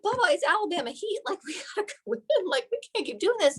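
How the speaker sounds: high whiny complaint to grandpa; high pleading to Papa about the heat. Retold or imitated. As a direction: whine like a hot, tired kid. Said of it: "I'm like".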